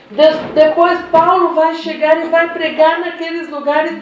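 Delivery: impassioned preaching. Real, not acted. Preacher explains God's timing for Paul to the church, impassioned. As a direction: drive the point home to the congregation.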